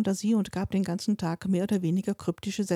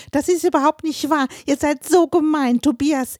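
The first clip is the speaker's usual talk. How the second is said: a much higher, much louder tearful scream; 8 semitones higher, 7 dB louder than usual. A narrator's reading. Eleven-year-old daughter fleeing upstairs, screaming at the family. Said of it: "brüllend".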